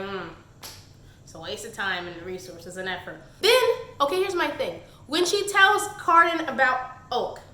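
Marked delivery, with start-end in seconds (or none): 0.0-3.2 soft, low, unimpressed verdict; dry disapproving hmm. Retold or imitated. Reviewer judging a wasted rescue, soft dry mutter. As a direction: mutter it like a tired judge. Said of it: "I was like".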